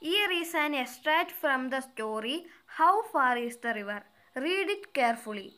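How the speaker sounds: slow, deliberate read-aloud of an English exercise; careful dictation pace; slow textbook reading. Acted. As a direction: read each word out clearly for the class. Reads instruction slowly.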